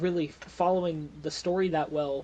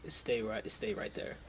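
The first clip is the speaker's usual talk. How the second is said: lower, soft, nearly whispered; low quiet order; 5 semitones lower, 7 dB quieter than usual. Acted. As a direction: murmur the order, low and firm. Bodyguard holding prisoner down.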